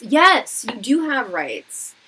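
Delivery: loud, slow, insistent declaration; emphatic yes. Real, not acted. Urging service workers to defend themselves, loud emphatic. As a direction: stress it like a rallying cry.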